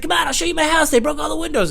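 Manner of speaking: high, loud insistent put-on voice; imitated paranoid neighbor. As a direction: urge him to come see. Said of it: "He's like".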